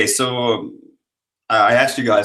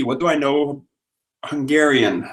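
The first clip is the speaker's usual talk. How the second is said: ordinary talk, nothing marked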